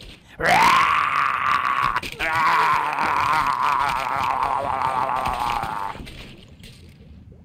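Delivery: loud gleeful villain laugh; evil cackle. Acted. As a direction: cackle over the feast. Shark's grand chomping finale.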